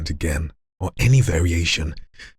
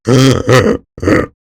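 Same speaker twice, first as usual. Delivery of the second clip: slowed inward gasp; 7 semitones higher, 10 dB louder than usual. Shown as a demonstration. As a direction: drag the gasp in slowly. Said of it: "sounds weird right now".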